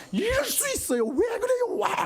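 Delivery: very high, strained mock shrieking; exasperated outburst. Frustrated talker venting at a partner, exasperated shrieking. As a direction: blow up in frustration, pitched right up. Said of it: "나는 막 그냥 답답해가지고".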